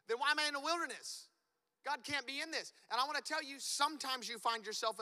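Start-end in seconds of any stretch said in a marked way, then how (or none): 0.1-2.7 higher, quick, indignant questioning; bewildered complaint. Pretend. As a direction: protest to God as if it's unfair. Believer questioning God in wilderness.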